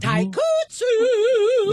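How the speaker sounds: very high, held final vowel; high drawn-out call. Pretend. Sidekick calling out a catchphrase for the host, high drawn-out call. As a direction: hold the last syllable long and high.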